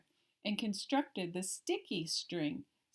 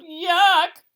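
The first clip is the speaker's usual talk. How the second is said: high, loud disgusted yell; loud shrieking monster yuck; 9 semitones higher, 15 dB louder than usual. Acted. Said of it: "The monster yelled".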